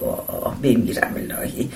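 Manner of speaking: lightly acted sighing dismay, breath on the first word; a touch of wincing sympathy; nose-wrinkled pity. A narrator's reading. Woman hearing of the stranger's ulcer, mildly dismayed with wrinkled nose. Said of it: "دماغش را چین داد و گفت".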